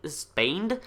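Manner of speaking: ordinary talk, nothing marked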